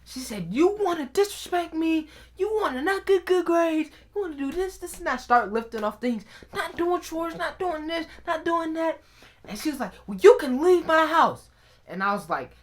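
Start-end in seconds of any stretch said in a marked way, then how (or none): 0.5-5.2 high-pitched indignant list; scolding mom. Retold or imitated. Mom to disrespectful son, high scolding. 6.5-9.0 high-pitched list of complaints; scolding mom. Retold or imitated. Mom to son about chores, high scolding. Mother listing offences. 10.0-11.5 high, loud dismissal; angry mom. Retold or imitated. Mom throwing son out, loud high anger.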